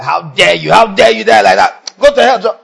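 loud, rising mock outrage; mock furious God. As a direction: play an offended God, hammed up. Mock angry God scolding.

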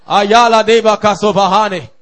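normal talking voice

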